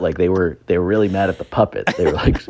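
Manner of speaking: ordinary talk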